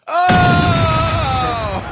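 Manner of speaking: sudden high excited yell; a real delighted whoop; excited shout. Real, not acted. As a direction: burst out shrieking with glee. Loud burst of excitement.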